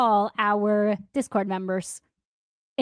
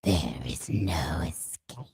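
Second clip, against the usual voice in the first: eerie slow whisper; 8 dB quieter than usual. Acted. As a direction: breathe it like a ghostly threat. Spooky whisper after padded room joke.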